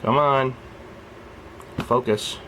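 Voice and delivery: usual speaking voice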